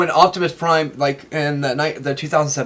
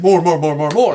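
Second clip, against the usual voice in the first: louder, insistent mock demand; eager demand; 6 dB louder than usual. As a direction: hammer out each repeat as an imitated greedy plea. Company demanding more, retold.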